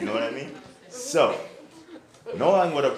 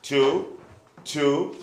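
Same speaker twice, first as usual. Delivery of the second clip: drill sergeant; 5 semitones higher, 7 dB louder, much slower than usual. Real, not acted. Counting push-ups aloud.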